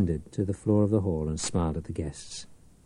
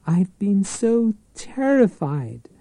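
put-on higher, slow girlish plea; little girl; 10 semitones higher, slower than usual. A narrator's reading. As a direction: whimper like a clinging girl. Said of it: "She was a little girl".

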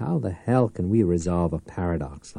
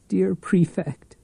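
put-on higher, slow girlish voice; cling like a little girl; 9 semitones higher, slower than usual. A narrator's reading.

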